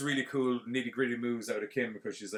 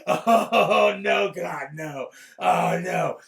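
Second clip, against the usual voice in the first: loud, high mock wail; exaggerated cowardly howling; whiny wailing; 9 semitones higher, 12 dB louder, much slower than usual. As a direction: bawl pathetically, hammed up. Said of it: "like a little bitch".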